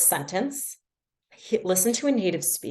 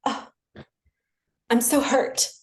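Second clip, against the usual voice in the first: slightly higher, hammed-up hurt after an offended gasp; mock wounded attitude; 4 semitones higher than usual. Learner retelling her silent sulk at being corrected, mock hurt. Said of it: "I had such an attitude".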